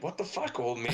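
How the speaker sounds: mock indignation. Acted indignant comeback to old mechanic.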